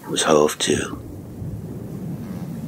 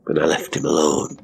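ordinary delivery, nothing marked

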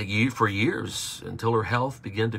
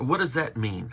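usual speaking voice

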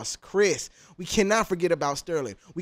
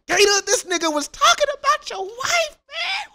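mock outrage. High mock outrage at the diss.

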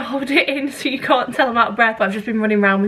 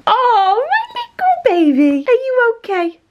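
cooing to the dog; 12 semitones higher, 4 dB louder than usual. Real, not acted. Fussing over her panting dog in high baby talk.

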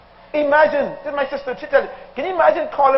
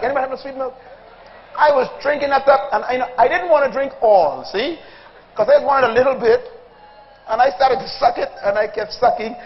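ordinary talk

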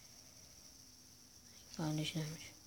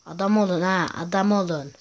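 loud, scolding yell of "adam olun"; scolding shout; 7 dB louder than usual. Real, not acted. Gamer yelling at game enemies, loud scolding shout. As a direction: bark it at the enemies on screen.

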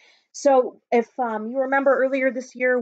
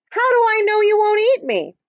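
high, louder suspicious question; suspicious cheese man; 10 semitones higher, 6 dB louder than usual. Acted. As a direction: ask it wary and pitched up. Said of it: "cheese man suspicious of fox".

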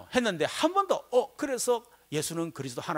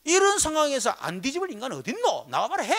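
angry retort; 9 semitones higher, 5 dB louder than usual. Retold or imitated. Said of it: "이러면서".

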